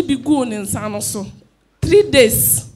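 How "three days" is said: louder, pressing "Three days" hard; loud emphatic. Real, not acted. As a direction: hammer the two words home. Testifier stresses the duration.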